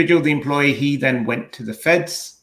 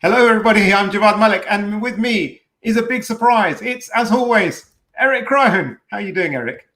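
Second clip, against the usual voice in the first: much higher, hammed-up host voice; put-on show intro; 8 semitones higher than usual. Host redoes intro as meant, high hammed-up voice. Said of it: "I was meant to do this".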